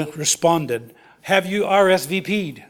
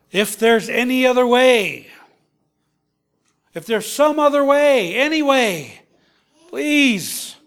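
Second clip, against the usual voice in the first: high, pleading voice, rising in distress; anguished plea; 6 semitones higher, 3 dB louder than usual. Acted. Jesus begging the Father in the garden, pleading high. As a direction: beg the Father for another way.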